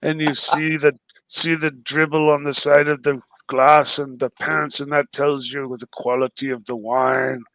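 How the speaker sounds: low, measured wine-connoisseur voice; Ocean. Acted. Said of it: "You can do an Ocean".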